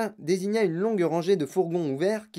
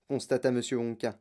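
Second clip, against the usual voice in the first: usual speaking voice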